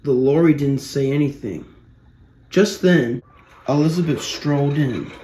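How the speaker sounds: usual speaking voice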